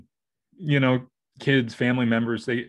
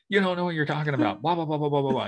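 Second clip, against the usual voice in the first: higher, rushed mimicry of a nagging retort; mock bickering; 5 semitones higher, faster than usual. Husband imitating his wife on the drift boat, bickering. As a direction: rattle it off high, dissolving into blahs. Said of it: "we start bickering back and forth".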